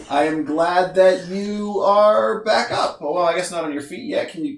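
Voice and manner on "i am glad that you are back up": slow, heartfelt relief; sincere. Acted. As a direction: say it slowly and mean it. GM voices NPC, sincere welcome.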